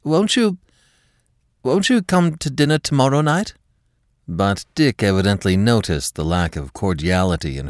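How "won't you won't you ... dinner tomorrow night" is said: forced natural. Acted. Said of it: "trying to make her voice sound as natural as possible".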